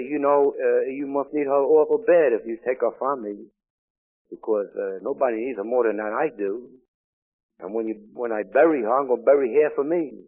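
grieving husband shouting his loss to God; anguished loud plea. Retold or imitated. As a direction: pour out raw grief, upward. Crying out to God over wife's death.

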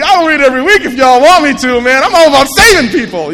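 loud, high and puffed-up; excited. Retold or imitated. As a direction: boast like a proud newcomer. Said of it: "I'm, like, all excited".

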